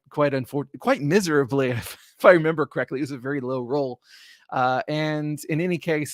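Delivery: ordinary delivery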